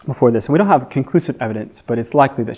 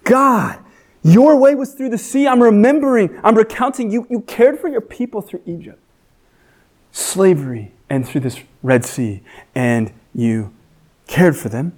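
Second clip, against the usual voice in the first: preaching to his soul; 7 semitones higher than usual. Acted. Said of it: "he's preaching to his soul".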